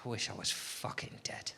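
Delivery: very soft, low, breath-heavy groaned thought; muttered despair. Acted. Comic voicing lunchtime thoughts, hushed hammed-up despair. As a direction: sigh it out under your breath in mock despair.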